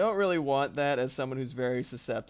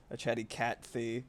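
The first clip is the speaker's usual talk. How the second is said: ordinary delivery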